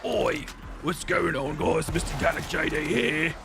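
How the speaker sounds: mock Manchester hooligan accent; badly faked English hooligan accent. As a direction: parody an English YouTuber's sign-on. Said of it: "fucking hooligan soccer player from Manchester".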